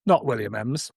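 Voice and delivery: ordinary talk, nothing marked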